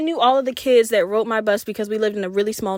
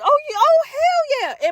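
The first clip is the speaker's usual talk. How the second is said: much higher and louder, gleeful; excited squeal; 3 dB louder than usual. Retold or imitated. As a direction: squeal yes like you just won. Boy asks her to be girlfriend.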